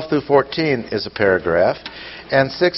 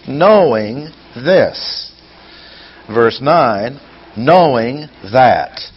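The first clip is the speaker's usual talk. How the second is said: slow emphasis; 3 dB louder, much slower than usual. Shown as a demonstration. Reads key verse words.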